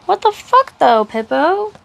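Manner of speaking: high-pitched outraged squeal; disbelief. Real, not acted. Reader squealing in outrage at Pippo's betrayal. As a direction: shriek in disbelief at the traitor.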